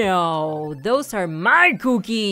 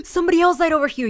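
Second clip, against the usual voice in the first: excited gasp; 7 semitones higher, 9 dB louder, much faster than usual. Real, not acted. Gasp, sudden discovery.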